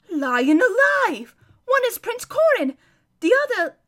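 high, loud amazed cry; amazed exclamation. Acted. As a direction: burst out in astonishment. Hermit amazed at the boys.

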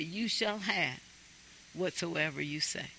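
sudden hush on the promise. Real, not acted. Preacher hushes the congregation for the promise, then quiet.

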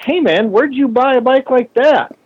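high, bright mock voice of an impressed passerby; curious stranger. Stranger asking the builder where he bought the bike, high mock voice. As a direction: play the stranger gawking at the bike. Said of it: "they'd say".

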